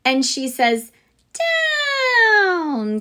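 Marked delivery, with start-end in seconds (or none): 1.3-3.0 high, loud witch voice calling Down; witch cry. A narrator's reading. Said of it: "cried the witch".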